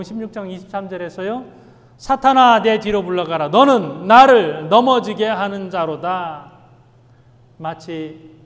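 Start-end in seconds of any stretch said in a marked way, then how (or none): 2.0-6.5 much louder, slow and commanding; stern shouted rebuke. Retold or imitated. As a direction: drive the words out like an exorcism. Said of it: "아주 단호하게". (베드로 안의 사탄을 꾸짖는 예수님, 크고 단호하게)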